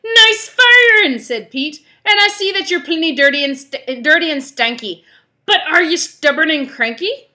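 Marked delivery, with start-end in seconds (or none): 0.0-1.2 loud, high, drawn-out pirate cheer. Acted. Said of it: "doing this pirate voice". 2.0-7.3 loud, raised pirate captain's growl; rally the crew as a rough pirate. Acted. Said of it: "doing this pirate voice".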